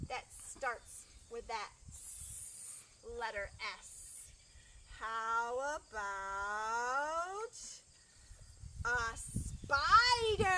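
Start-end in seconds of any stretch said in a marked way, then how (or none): 5.0-10.6 suspense reveal. Shown as a demonstration. Teacher teases children before showing the spider. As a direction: stretch the build-up, then burst out the answer. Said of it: "suspense reveal".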